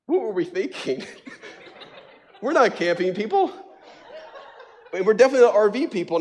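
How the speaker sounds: high, laughing disbelief; can barely get it out for laughing; giggling wife, exhausted and giddy; laughing while talking. Retold or imitated. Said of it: "she goes".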